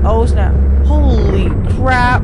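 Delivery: loud, high panicked yelling. Real, not acted. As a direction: scream it as you flee. Chased by monsters.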